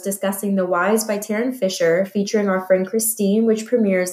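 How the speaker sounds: cheerful episode-promo voice; podcast outro read. Acted. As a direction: announce the next episode brightly.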